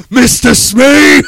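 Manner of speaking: slow booming shout of the name; pirate shout. Retold or imitated. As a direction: bellow it like a pirate captain.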